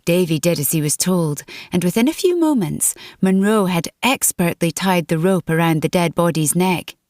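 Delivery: plain reading voice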